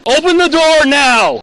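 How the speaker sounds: high, loud shouted order; shouting. Real, not acted. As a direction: yell it, slowly and hard. Officer commands at car door.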